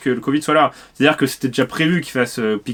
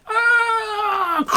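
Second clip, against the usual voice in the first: exasperated shout. Acted. Outburst mid rant about YouTube.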